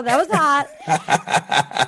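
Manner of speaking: very slow, laughing "That was hot"; slow laughing drawl. Retold or imitated. Drawn-out final repeat.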